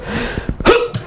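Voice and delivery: a sudden high breath sucked in; sharp straining gasp. Shown as a demonstration. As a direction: gasp in as you clench every muscle. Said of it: "You see how my face turned red?".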